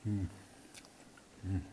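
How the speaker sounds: chewing with a crunch. Real, not acted. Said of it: "I hope you can hear the crunch in the mic".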